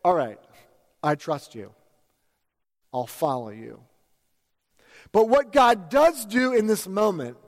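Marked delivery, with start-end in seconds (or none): 0.0-3.8 low and softer, quietly trusting; a wife's hushed reply, fading away. Retold or imitated. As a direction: gently give in to her husband. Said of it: "She may have said".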